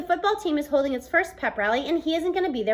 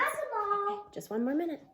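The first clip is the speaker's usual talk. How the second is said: quiet aside; 8 semitones higher, 9 dB quieter than usual. Real, not acted. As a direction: murmur it away from the mic. Reader's aside to a child offmic.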